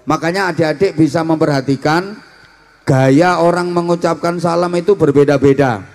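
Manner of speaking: ordinary talk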